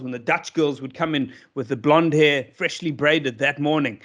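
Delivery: ordinary talk, nothing marked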